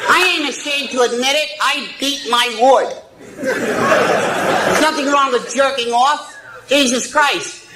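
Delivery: crude puppet voice, cackling; squeaky ventriloquist dummy. Acted. Ventriloquist act, dummy speaking.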